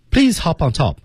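high, run "hop on top" together; linked rhythm. Shown as a demonstration. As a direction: link "hop on top" as one rhythmic unit. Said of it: "我们看整个这个句子的节奏". (有节奏地连读“hop on top”)

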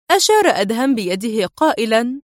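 ordinary delivery

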